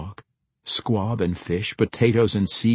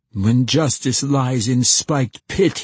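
loud, slow, higher angry declaiming; raging recitation; 5 semitones higher, 5 dB louder, slower than usual. A narrator's reading. Young man finishing his verse as anger replaces self-pity, raging. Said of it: "his voice quivering with rage".

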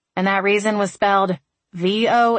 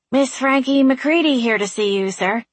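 very formal tone; 6 semitones higher than usual. A narrator's reading. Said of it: "she said in a very formal tone".

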